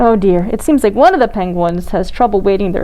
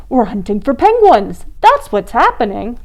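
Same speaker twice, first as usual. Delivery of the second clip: plain reading voice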